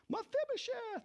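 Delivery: high ringing call of a name; the king calls his name out across the hall. Acted. King David calls out to Mephibosheth, ringing high call. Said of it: "Exclamation point".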